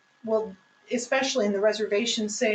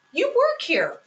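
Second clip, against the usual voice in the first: disbelieving retort, pitched up and louder; incredulous; 9 semitones higher, 4 dB louder than usual. Real, not acted. Guest scolding a clueless server, incredulous and raised. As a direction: point out the obvious in disbelief.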